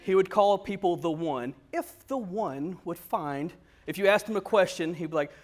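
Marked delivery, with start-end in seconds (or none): 1.7-3.5 oddly measured, slow and soft professor; slow, soft, stilted imitation; Doctor Vaughn. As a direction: imitate a quirky scholar, drawing each word out. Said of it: "he talked very weird".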